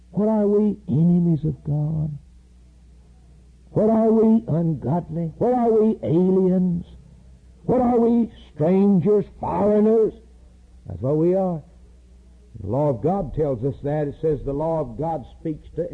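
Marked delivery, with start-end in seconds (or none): 0.1-10.1 fervent preaching crescendo; rising preacher's cry. Real, not acted. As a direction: hammer the questions home, building. Preacher building rhetorical questions.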